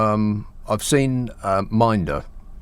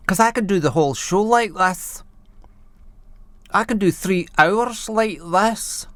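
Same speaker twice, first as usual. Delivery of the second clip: higher, slower Glaswegian lilt, camped up; 6 semitones higher than usual. Pretend. Presenter mocks a complainer in Glaswegian accent. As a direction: lay the Glaswegian accent on thick and slow.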